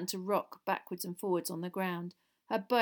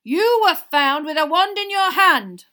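slow, very loud, raised-pitch shout; barked accusation; 9 semitones higher, 17 dB louder, slower than usual. A narrator's reading. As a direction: bark it at the accused. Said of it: "barked Mister Diggory".

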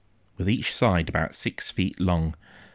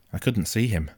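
plain reading voice

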